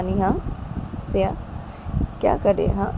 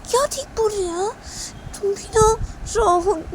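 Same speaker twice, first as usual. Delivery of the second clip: much higher put-on voice; character voice. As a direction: tease Rahul in a high voice. Ravi voiced as high character.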